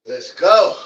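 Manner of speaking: hype call. Real, not acted. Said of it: "hyping himself mid-workout".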